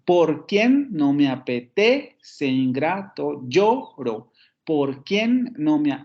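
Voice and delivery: "por quien no me apetece, ingrato, lloro" slow, QUIEN and TE lifted; deliberate "por QUIEN no me a-pe-TE-ce"; stress on second syllable. Shown as a demonstration. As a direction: scan slowly, leaning on QUIEN, TE and LLO. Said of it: "tenemos el acento en la segunda sílaba".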